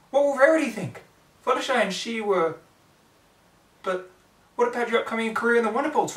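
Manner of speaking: high, loud frantic pleading; panicked scolding. Acted. Twilight scolding Rainbow Dash, high and loud.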